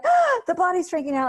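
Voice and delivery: high, loud panicked cry of alarm; a client's shrill panic as the body freezes. Retold or imitated. Client panicking about a frozen body, high loud alarmed cry.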